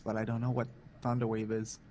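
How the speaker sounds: ordinary delivery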